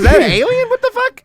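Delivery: high, loud mock shock; mock alarmed shock. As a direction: yelp in fake alarm. Mock alarm in toilet scene.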